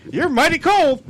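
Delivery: high, loud comic line reading; acted film quote. Quoting a film's comic line.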